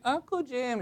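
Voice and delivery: high-pitched mock scream, held back in volume; imitated child's scream. Niece calling her uncle during prayer, high restrained mock scream. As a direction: squeal it high like a little niece. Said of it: "screams at the top of her lungs".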